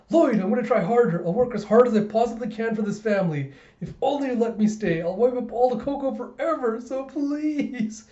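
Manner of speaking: high, imploring voice climbing to the end; eager, high-pitched promise-making; earnest pleading wife. Retold or imitated. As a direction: beg with hammed-up devotion. Wife pleading with her husband.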